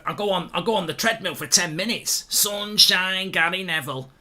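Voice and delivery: a bad, hammed-up Manchester accent held on every line; Gary Neville impression. Streamer mocks Everton's defence in a Manchester accent. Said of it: "I can't do the accent".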